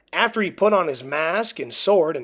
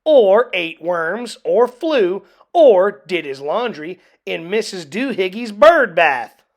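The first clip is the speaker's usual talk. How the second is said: scolding; 3 semitones higher, 8 dB louder than usual. A narrator's reading. Mother listing her son's misdeeds, loud scolding. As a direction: keep scolding, louder. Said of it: "she scolded".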